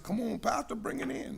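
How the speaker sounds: lightly acted urging from the sidelines. Drunk guy egging the pastor on, lightly acted urging.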